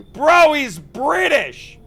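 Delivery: excited exclaim. Real, not acted. Player laughing to friend about the British NPC. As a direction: shout it out amused.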